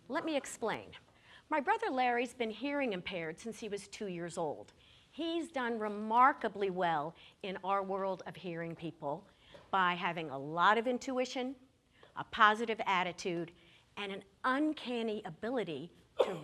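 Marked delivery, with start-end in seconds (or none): none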